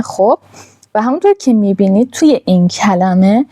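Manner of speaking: ordinary talk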